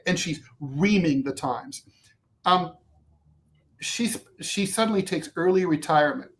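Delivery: ordinary talk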